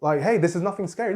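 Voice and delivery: hammed-up impatient parent questioning a toddler; exasperated parent. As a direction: play the parent who can't see why the kid is upset. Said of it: "Do we just".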